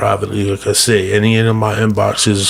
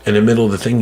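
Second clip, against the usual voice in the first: ordinary talk, nothing marked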